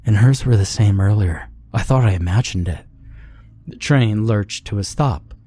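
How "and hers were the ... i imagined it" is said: usual speaking voice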